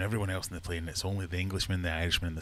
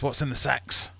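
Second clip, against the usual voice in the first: raised, higher-pitched question; demanding commander; 6 semitones higher, 7 dB louder than usual. Acted. Commander asking about the sacks, loud demanding voice. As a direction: demand an answer like an officer.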